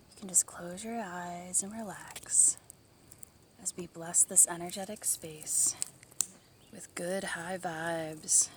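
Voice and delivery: slow, hushed spa whisper; soft spa whisper. Acted. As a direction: breathe the words softly to relax them. Guided blessing in whisper.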